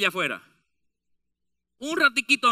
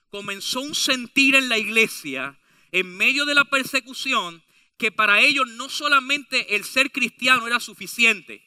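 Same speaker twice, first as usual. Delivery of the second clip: ordinary delivery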